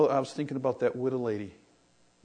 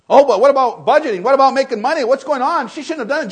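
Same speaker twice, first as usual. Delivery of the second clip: loud, high scoffing objection; a scandalized skeptic scolding the widow; mock objector; 10 semitones higher, 14 dB louder than usual. Preacher mocking a critic of the widow, loud and high.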